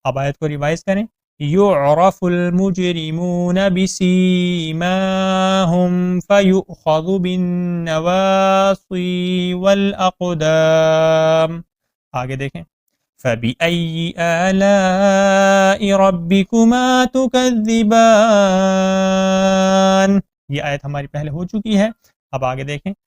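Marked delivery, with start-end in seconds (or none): 1.4-11.6 slow melodic tajweed chant; Quran recitation. Shown as a demonstration. 13.2-20.2 slow melodic tajweed chant; Quran recitation. Shown as a demonstration.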